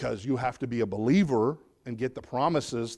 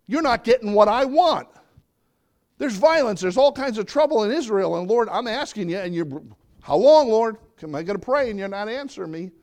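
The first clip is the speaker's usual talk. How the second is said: higher, louder, frustrated pleading to God; pleading Habakkuk; 9 semitones higher, 7 dB louder than usual. Acted. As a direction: plead like a prophet who gets no answer. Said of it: "Habakkuk saying".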